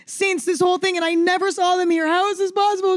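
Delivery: high frantic protest to mom; hysterical disbelief. Retold or imitated. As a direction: plead it high, totally freaked out. Hysterical scene in closet.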